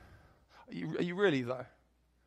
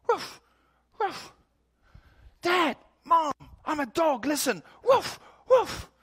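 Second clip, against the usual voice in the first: put-on much higher, loud excited child yelping woof; barking little boy; 7 dB louder than usual. Little son barking at his parents in the yard, high excited shouting. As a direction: shout it like a small boy playing dog. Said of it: "starts running around the yard one day barking".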